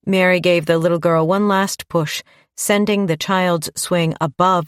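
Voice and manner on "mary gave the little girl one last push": ordinary delivery, nothing marked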